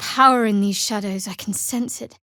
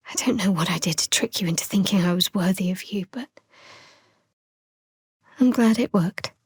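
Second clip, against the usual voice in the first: whispered confession; 3 semitones lower, faster than usual. Acted. Confessing to a lover, fast whisper. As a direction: breathe it close to their ear.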